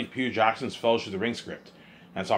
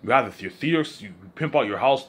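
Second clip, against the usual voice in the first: ordinary talk, nothing marked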